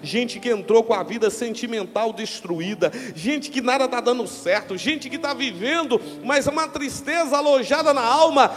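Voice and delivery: rising into loud preaching; building sermon. Acted. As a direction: build to a fiery climax.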